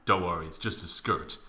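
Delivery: mock boyfriend voice. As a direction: drop low and soft to play the easygoing boyfriend. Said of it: "he was like".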